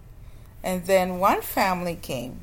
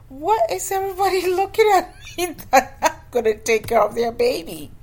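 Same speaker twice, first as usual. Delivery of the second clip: much higher, laughing through the question; incredulous, laughing it out; laughing disbelief; 10 semitones higher, 5 dB louder than usual. Retold or imitated.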